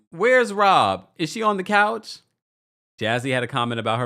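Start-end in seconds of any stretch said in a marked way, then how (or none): 0.1-2.2 high, loud mock calling-out; imitating a castmate's jab. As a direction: loudly mimic someone asking after a missing friend. Repeats Wendy's confessional jab.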